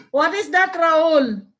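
usual speaking voice

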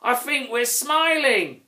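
high, delighted troll cry; excited cry. A narrator's reading. Grouch cries out to the grunt about smiling, high and delighted. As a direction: burst out with happy wonder. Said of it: "the grouch cried".

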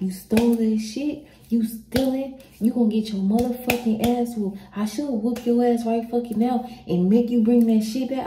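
mock angry dad telling off his kid; angry dad scolding. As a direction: scold like a mad father. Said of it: "He was like".

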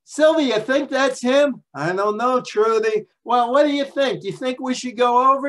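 high, loud excited chatter of two women; gossiping New York ladies. Retold or imitated. Two vacationing women spot Joe on beach, high excited gossip. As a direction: squeal it like starstruck fans. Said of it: "And I could just hear this".

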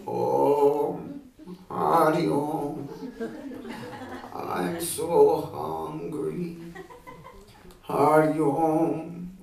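put-on slow, loud, drawn-out old beggar; hungry old sage begging, slow and pleading; frail old holy man. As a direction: creak out the chant like an ancient hermit.

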